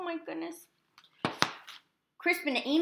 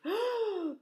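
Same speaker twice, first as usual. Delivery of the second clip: high gasp; 13 semitones higher than usual. Acted. The roof catches fire.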